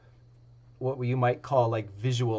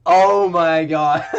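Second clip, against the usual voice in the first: laughing disbelief; 3 semitones higher, 11 dB louder, slower than usual. Real, not acted. Reaction to the lucky roll.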